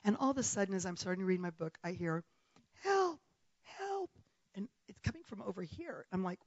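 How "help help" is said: high, distant cry for help without volume; thin, high call from far away; mock cries for help. Retelling what was heard on the beach, mock cry for help. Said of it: "people are shouting for help".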